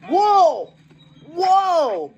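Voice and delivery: high, swooping cry; loud, high yelp of alarm; alarmed whoa. Acted. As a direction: yell whoa, swooping up then down. Passengers on a wild ride.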